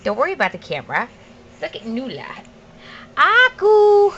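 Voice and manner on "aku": put-on high sing-song word for baby; cooing first-word prompt; high coaxing prompt. Shown as a demonstration. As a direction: coax the baby to repeat it, pitched up. Coaching baby's first words.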